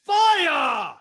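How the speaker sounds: loud command to fire; volley command; shouted order. Acted. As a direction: bark the order to the archers. Pirate captain orders volley.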